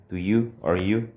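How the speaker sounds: usual speaking voice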